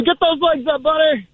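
high shouted encouragement; cheering. Acted. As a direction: yell it from the sidelines. Cheering at a cross-country meet.

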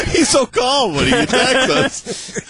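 high, slow, laughing shout. Real, not acted. Cohost cracking up at the doctored clip. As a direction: crack up while saying it.

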